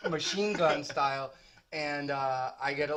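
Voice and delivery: ordinary talk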